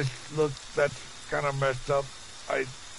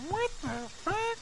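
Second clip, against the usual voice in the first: high, squeaky shocked voice; hammed-up high-pitched what the fuck; squeaky mock quote; 9 dB quieter than usual. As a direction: imitate someone squealing in shock. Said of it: "Somebody's all like".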